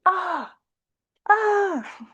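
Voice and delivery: mock distress. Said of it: "And you're like".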